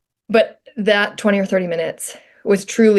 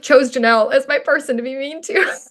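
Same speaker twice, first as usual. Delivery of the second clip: usual speaking voice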